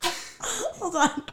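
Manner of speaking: high voice squeezed through laughing; helpless laughter. Real, not acted. Cohost losing it over the old show's song, real helpless laughter. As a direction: struggle to talk while cracking up. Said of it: "I have to gather myself".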